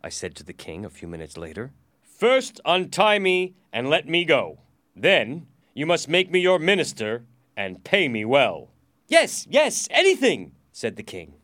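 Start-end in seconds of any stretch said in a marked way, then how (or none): 2.0-8.7 loud, raised, insistent demands; dictating terms. Acted. Prisoner dictates terms to king. 9.1-10.5 loud, high, frantic consent; desperate agreement. Acted.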